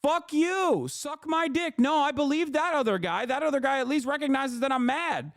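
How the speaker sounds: high, mocking angry yell; hammed-up furious voter telling off the party. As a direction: spit it back at them, fed up. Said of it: "be like".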